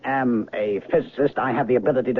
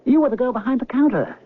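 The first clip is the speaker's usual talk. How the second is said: agitated insistence; 10 semitones higher than usual. Acted. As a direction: push him up high, bewildered and exasperated. Man insists she was clerk.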